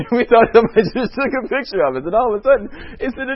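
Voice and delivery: high, loud laughing talk. Real, not acted. Pastor tells the congregation of the article, laughing. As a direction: laugh through the words in disbelief. Laughing about the newspaper surprise.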